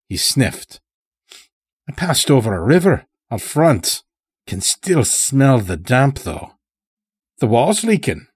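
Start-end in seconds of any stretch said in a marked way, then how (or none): 1.9-8.3 put-on a higher, odd country voice for the old stranger; sly old-timer with an unplaceable accent; Morris's strange accent. A narrator's reading. Chained old stranger talking to the lodge master, in an odd accent. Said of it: "spoke in such a strange way".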